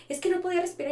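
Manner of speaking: put-on small child explaining; imitating a child. Therapist mimics a child describing an anxiety attack, high child voice. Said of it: "Entonces ya me dice".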